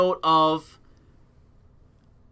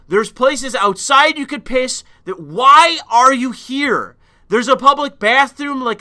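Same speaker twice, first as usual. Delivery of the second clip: raised, higher, louder telling-off; angry scolding; 5 semitones higher, 5 dB louder than usual. Retold or imitated. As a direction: chew the guy out at two in the morning. Scolding the pissing man.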